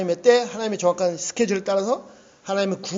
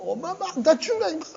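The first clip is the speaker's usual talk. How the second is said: high-pitched mock child nagging mom; 떼쓰는 아이 흉내; 9 semitones higher than usual. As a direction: imitate a whiny kid demanding food. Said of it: "막 이러고 있는 그 아이". (높은 목소리로 떼쓰는 아이 흉내)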